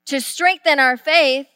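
usual speaking voice

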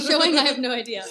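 loud laughing while talking; giggling through the words. Real, not acted. Laughing over the flubbed word.

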